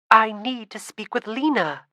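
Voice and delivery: pleading. Acted. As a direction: beg to be let through, a touch urgent. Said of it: "Kendra pleaded".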